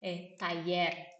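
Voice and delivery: taller said slowly, ta-YER; slow Spanish word. Shown as a demonstration. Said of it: "a segunda palavra muito importante".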